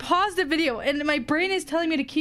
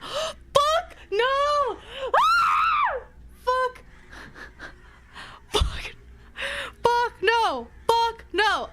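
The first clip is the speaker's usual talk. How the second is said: terrified screams; 8 semitones higher, much slower than usual. Real, not acted. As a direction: scream in sheer fright. Jump scare while reading.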